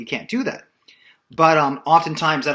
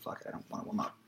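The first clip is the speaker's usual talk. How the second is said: mock lazy mutter; 17 dB quieter, faster than usual. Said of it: "I was like".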